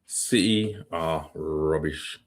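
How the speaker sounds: "City are rubbish" said slowly, word by word; deliberate, slowed-down repeat; slow emphatic verdict. Real, not acted. Let's be real.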